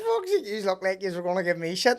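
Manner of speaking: high, loud, incredulous retelling; loud retold exclamation. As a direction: blurt it out over the laughter. Retelling his reaction amid laughter.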